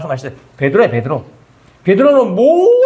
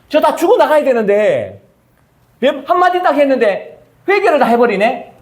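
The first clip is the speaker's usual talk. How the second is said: high, incredulous indignant prophet; indignant Jonah; 7 semitones higher than usual. Retold or imitated. Jonah fuming that Nineveh repented, high and incredulous. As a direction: sputter in disbelief that they repented.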